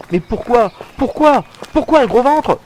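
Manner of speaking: high, rising cries of despair; anguished, escalating protest; desperate outcry. Acted. Desperate questioning of God.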